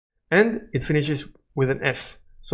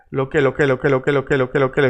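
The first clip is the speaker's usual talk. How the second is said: "lo que" looped without a break; rhythmic repetition drill; much faster than usual. Shown as a demonstration. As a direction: rattle "lo que" round and round, evenly. Rapid repetition drill of linked syllables.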